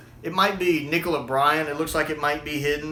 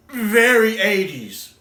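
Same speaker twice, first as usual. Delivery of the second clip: VERY drawn out, loud and higher; emphatic stretch; 5 semitones higher, 6 dB louder, much slower than usual. Real, not acted. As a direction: lean hard and slow on "very". Emphatic repeat of his verdict.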